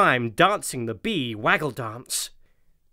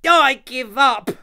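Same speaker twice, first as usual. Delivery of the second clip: high, loud, slow despairing yell; 9 semitones higher, 7 dB louder than usual. A narrator's reading. Tom giving up on bath time, a slow loud yell. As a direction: throw up your hands and yell it. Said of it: "yelled Tom".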